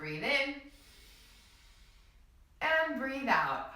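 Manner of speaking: slow release on out; guided breathing cue. Shown as a demonstration. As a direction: let the words ride the exhale. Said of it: "One more time".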